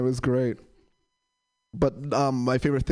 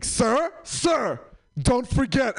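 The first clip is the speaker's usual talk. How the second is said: lightly raised calling cashier; 9 semitones higher, much slower than usual. Retold or imitated. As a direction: call after the customer. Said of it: "he was like".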